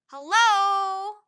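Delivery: put-on a boy's high, very loud hello; shouting. A narrator's reading. Boy shouts up at Jack and Annie in the treehouse, very loud high shout. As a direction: call up to strangers at full voice. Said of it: "the boy shouted".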